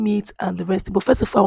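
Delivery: ordinary talk, nothing marked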